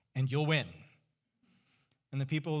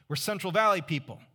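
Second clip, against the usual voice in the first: ordinary delivery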